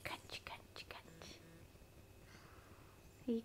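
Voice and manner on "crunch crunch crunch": soft whispered crunching sound words. Pretend. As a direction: breathe out the crunches playfully. Mom imitates apple crunching.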